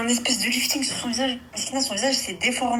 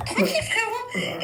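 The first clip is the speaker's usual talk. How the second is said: imitating boyfriend; 11 semitones higher, slower than usual. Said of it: "il m'a dit".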